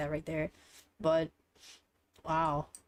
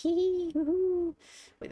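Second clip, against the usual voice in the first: excited squeal; 11 semitones higher than usual. Real, not acted. Sudden squeal on gacha pull.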